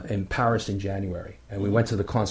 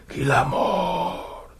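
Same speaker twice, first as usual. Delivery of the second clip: slow, wailing, drawn-out ghostly moan; 7 semitones higher, much slower than usual. Retold or imitated. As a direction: imitate the eerie male voice on the recording. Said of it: "Just like that".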